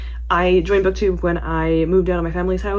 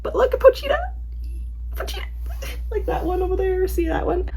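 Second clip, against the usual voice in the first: cute gushing; 12 semitones higher than usual. Real, not acted. Fan showing viewers a cute Pochita gift, cooing high. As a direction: coo over it like a plush toy.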